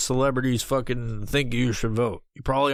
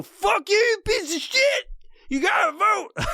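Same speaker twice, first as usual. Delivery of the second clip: mock furious yell. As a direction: scream it like a crazed vote-pusher. Mock yelling at a voter.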